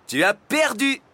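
high, loud gloating cry; jubilant. A narrator's reading. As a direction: crow over your win. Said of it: "jubila le facteur". (cri jubilant, haut et fort)

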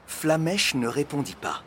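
usual speaking voice

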